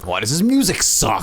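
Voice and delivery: high mocking whine. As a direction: sneer it up high. Joking mock question.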